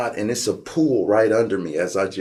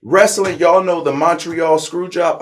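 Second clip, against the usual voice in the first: ordinary talk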